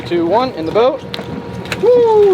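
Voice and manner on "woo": high, loud whoop of joy; excited whoop. Real, not acted. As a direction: let out a thrilled yell. Fish lands in boat, whoop.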